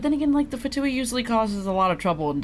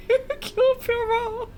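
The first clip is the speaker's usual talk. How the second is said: laughing mock exclamation; slower than usual. Real, not acted. Mocking her own deduction, laughing.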